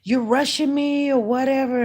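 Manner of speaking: high-pitched imitation of a complainer; mock screaming. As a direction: mimic a yelling woman without real volume. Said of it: "she was screaming at me".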